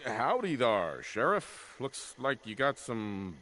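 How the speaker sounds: swooping, exaggerated Wild West twang; over-the-top cowboy greeting a sheriff; hammed-up cowboy. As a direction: play along as a cartoon cowboy, pitch sliding up and down. Player plays along as cowboy deputy.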